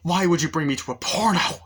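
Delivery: high, loud outraged question; mock offended shout; indignant retort. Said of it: "he's like".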